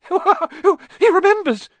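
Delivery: very high, loud, slow squeal of joy; cried out. Acted. Diggle thrilled Harry remembers him, squealing high. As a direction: shriek with delight. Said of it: "cried Daedalus Diggle".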